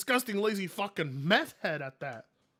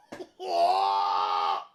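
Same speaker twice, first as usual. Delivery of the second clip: shrieking laugh; 12 semitones lower, 6 dB louder than usual. Real, not acted. Reacting to gross clip.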